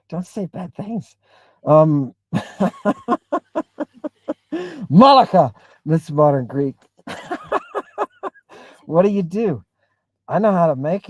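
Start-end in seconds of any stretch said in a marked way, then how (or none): 2.3-4.8 real laugh, pitched up; amused high chuckle. Real, not acted. Reacting to a comment. 7.1-8.3 very high real laugh; high-pitched giggle; squealing laugh. Real, not acted. Reacting to a comment.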